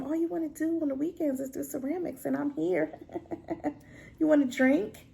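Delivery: soft, hushed imitation of coaxing; imitated sweet-talking mom. Voices the mom sweet-talking dad.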